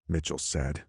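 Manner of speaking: usual speaking voice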